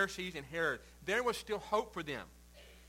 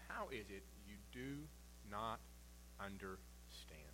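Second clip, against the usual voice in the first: hushed, drawn-out reading, fading to a whisper on understand; soft, slow, whispered ending; soft, slow question; 8 semitones lower, 15 dB quieter, slower than usual. Acted. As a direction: let the question sink in quietly. Jesus' question read softly.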